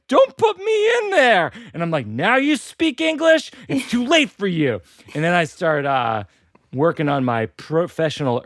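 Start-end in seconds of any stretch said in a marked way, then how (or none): 0.1-1.5 very high, drawn-out mock wail; panicked intern. Old laptop being stuffed into the new one, mock terror. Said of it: "And the intern is like". 2.1-4.8 mock outrage. Owner to the laptop that suddenly speaks English, mock indignation. Comic quarrel with the old laptop.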